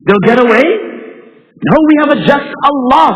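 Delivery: high, loud, impassioned preaching; fiery preaching. Acted. Preacher rousing the congregation about unpunished tyrants, at full force. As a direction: drive it home from the pulpit.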